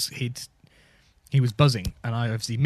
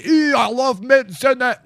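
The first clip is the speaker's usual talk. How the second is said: higher, louder, slow delighted cry; mock gleeful shout; 10 semitones higher, 7 dB louder, slower than usual. [name] raving about the mint juice, hammed-up shout. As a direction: relish it at full volume.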